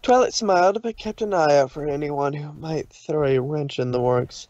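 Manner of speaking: usual speaking voice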